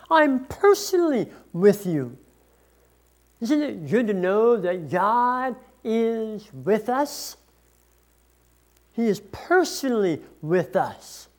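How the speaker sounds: usual speaking voice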